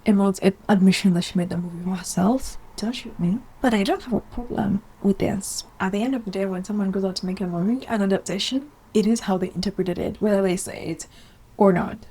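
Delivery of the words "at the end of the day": usual speaking voice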